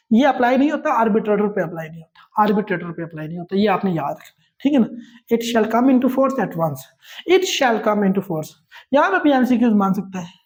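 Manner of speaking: usual speaking voice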